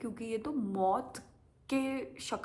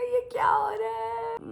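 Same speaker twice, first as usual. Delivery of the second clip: much higher, loud, slow panicked shriek; terrified cry; 7 dB louder, slower than usual. Retold or imitated. Passenger on racing bike crying out in fear. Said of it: "मैंने कहा".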